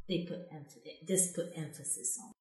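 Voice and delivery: ordinary delivery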